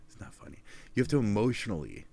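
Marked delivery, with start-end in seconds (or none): 0.1-0.6 breathy whisper, much softer; soft whispered repeat. Acted.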